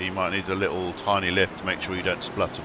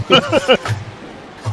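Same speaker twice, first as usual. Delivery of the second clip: burst of laughter; 9 dB louder than usual. Real, not acted. Joke about the Acura captions.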